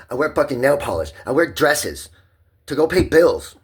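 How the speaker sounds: loud, high rant building to a shout; worked-up yelling in self-defense; real defiant outburst. Real, not acted. Defending emotions against being medicated.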